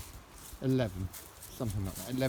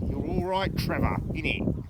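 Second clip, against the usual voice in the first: high, loud cheeky voice for the dog; a hint of teasing banter aimed at the dog; playful dog talk; 6 semitones higher, 6 dB louder than usual. Owner teasing his dog Trevor with a cheeky voice.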